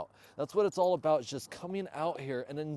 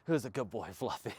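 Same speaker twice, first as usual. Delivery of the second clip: softer, lower doting praise; 4 semitones lower, 5 dB quieter than usual. Real, not acted. Owner cooing at his dog, soft and warm. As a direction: coo at the good dog.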